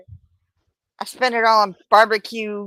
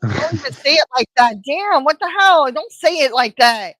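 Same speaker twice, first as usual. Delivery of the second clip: alarmed scolding; 3 semitones higher, 4 dB louder than usual. Real, not acted. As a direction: scold them in real alarm. Reacts alarmed to co-host's wording.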